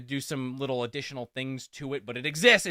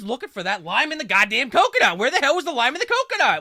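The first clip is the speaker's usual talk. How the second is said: exasperated; 10 semitones higher, 9 dB louder than usual. Real, not acted. Exasperated complaint.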